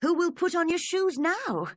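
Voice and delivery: put-on high girlish fretting; Alice's worried voice. A narrator's reading. Alice fretting about her distant feet. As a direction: fret aloud like a little girl.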